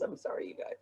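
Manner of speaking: whispered, worn out by laughing; faint voiceless apology after a laughing fit; breathless apology. Real, not acted. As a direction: barely get the words out.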